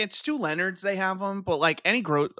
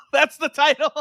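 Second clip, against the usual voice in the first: laughing; 10 semitones higher than usual. Real, not acted. Laughing at joke.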